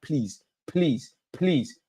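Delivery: ordinary delivery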